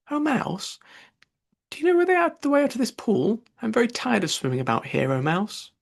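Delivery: Alice solemnly addressing a mouse, high and formal. Acted. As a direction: address the mouse with grand, careful politeness. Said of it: "the right way of speaking to a mouse".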